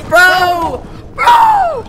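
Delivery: very high, loud screamed calls; hammed-up terrified yell; acted alarm. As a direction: scream for your friend in panic. Acted monster chase in skit.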